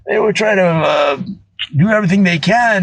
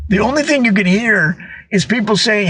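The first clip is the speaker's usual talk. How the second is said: normal talking voice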